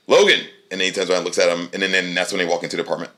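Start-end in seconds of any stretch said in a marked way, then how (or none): 0.1-0.4 high, loud call. Retold or imitated. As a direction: call his name across the street. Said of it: "And then he's like".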